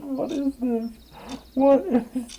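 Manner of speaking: high falsetto disbelief; high-pitched what-is-this; squeaky bafflement. Real, not acted. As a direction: squeal the question in confusion. Baffled reaction to game.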